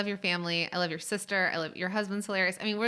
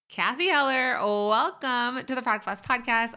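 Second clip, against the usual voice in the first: louder, higher show-host greeting; excited welcome; 5 semitones higher, 7 dB louder than usual. Real, not acted. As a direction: open the interview with a big welcome.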